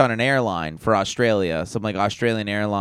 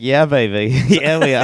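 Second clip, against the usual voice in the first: Austin Powers voice; 2 semitones higher, 6 dB louder than usual. Retold or imitated. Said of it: "Are they Austin Powers?".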